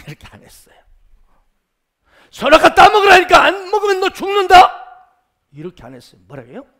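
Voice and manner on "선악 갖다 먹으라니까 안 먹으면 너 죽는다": high, full-volume threatening yell; coercive threat. Acted. Preacher shows how Satan did not threaten Eve, aggressive yell. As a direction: scream the order as a bullying threat. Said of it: "공격적으로, 강압적으로".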